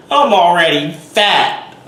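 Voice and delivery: loud, high, drawn-out repeat; mock exasperated yell. Vlogger shouting back at imagined critics, mock exasperated yell.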